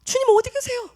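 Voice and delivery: high, searching call to God; pleading prayer. Acted. Acted believer's prayer to God.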